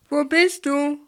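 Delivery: loud, high call into the empty lab. A narrator's reading. Bert calls for his friend in the lab, loud call. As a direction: call out to find him. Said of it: "rief er".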